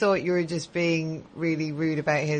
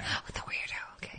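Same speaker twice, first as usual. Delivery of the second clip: whisper; 13 dB quieter than usual. Real, not acted. Gossip about staffer nearby.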